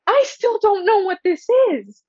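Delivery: high, bewildered inner voice; mock bewilderment. Sitting through the dean's pitch, a high baffled inner voice. Confused while dean pushes mock trial.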